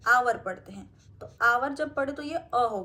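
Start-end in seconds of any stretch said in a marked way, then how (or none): 0.1-0.4 आवर said with no h sound at the start; silent h. Shown as a demonstration. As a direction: say आवर beginning straight on the vowel. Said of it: "अपन इसको हावर नहीं पढ़ते हैं". (आवर को बिना ह की आवाज़ के बोलना)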